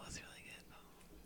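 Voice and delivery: hushed aside; whispering. Real, not acted. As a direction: whisper it under your breath. Whispers aside during recording.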